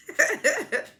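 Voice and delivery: laughing high and squeaky; high squealing laugh. Real, not acted. High laugh after quote.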